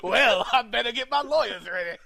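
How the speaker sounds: put-on loud Southern gentleman accent; Billy D Williams. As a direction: boom it out as a hammed-up Southern gentleman. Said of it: "I like to envision he's a Southern gentleman".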